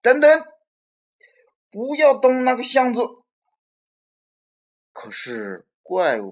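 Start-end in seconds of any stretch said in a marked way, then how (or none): 0.0-3.0 much higher, loud shriek; screaming. Acted. Robert screams at the monster to leave the toy box, loud shriek. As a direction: scream to stop him. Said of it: "连忙尖叫起来".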